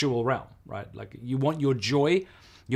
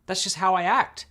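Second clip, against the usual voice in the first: defensive man; 7 semitones higher, 4 dB louder than usual. Retold or imitated. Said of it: "the man was like".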